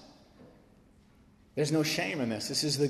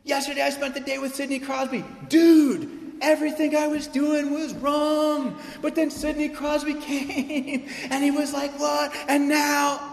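put-on much higher, excited show-off kid voice; bragging kid; 11 semitones higher, 3 dB louder than usual. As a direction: play a boastful kid bursting with news. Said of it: "He's like".

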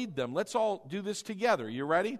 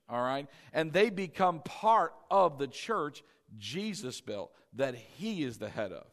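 ordinary delivery

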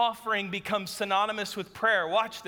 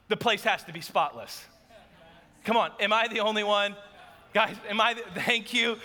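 usual speaking voice

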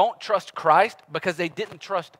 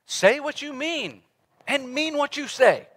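higher and very slow, every word weighted; slow, emphatic maxim; 10 semitones higher, slower than usual. Shown as a demonstration. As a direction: spell it out slowly like a motto. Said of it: "as simply as I can put it".

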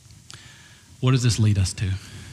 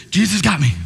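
breezy grace person; 6 semitones higher, 4 dB louder than usual. Retold or imitated. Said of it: "tails people".

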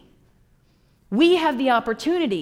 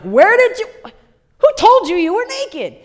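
high, loud demanding question; God confronting Adam; 8 semitones higher, 6 dB louder than usual. Retold or imitated. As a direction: press him for an answer.

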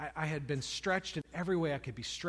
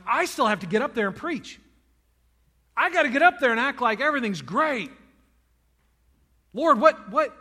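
rising frustrated outburst, louder and higher to a shout; frustrated cry; 7 semitones higher, 12 dB louder than usual. Retold or imitated. Pastor crying out to God before preaching, exasperated shout. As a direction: cry out in exhaustion to the Lord. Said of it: "And I'm like".

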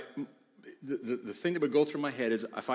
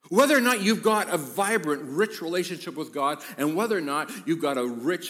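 loud, higher-pitched pulpit proclamation; preaching at full force; 4 semitones higher, 6 dB louder than usual. Real, not acted. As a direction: drive it home to the congregation, loudly.

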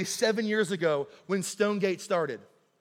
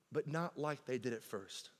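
much softer, lower ending; quiet contrast; 6 semitones lower, 12 dB quieter than usual. Acted. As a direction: drop the voice on the contrast. Repeated sermon line.

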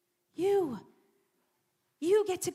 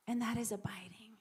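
soft whispered close to the quote; 5 semitones lower, 8 dB quieter than usual. Real, not acted. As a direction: let the words fall away into a whisper. Quoting Jesus, quieting down.

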